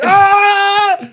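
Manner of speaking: high, loud triumphant roar; chest-beating yell. Retold or imitated. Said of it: "I was, like, beating my chest. I'm like".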